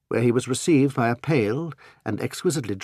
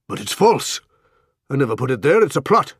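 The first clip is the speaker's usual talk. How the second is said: louder, higher alarmed protest; panicked denial; 5 semitones higher, 6 dB louder than usual. Acted. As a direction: blurt it out in rising panic. Isaacstein panics, denying the plot.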